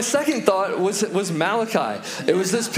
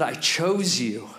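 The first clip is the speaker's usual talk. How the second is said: slow, lower, sincere; tender assurance; 4 semitones lower, slower than usual. Retold or imitated. God looking the Israelites in the eyes, tenderly reassuring. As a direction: say it gently and let it sink in. God's tender answer, preached.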